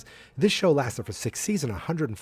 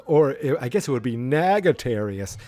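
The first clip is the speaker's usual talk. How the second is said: ordinary talk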